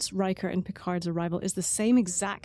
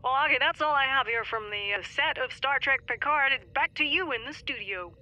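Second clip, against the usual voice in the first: a hint of reporter handing back, higher; lightly voiced field reporter; 4 semitones higher than usual. Correspondent handing back to the studio, mock reporter. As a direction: lightly play the correspondent signing off.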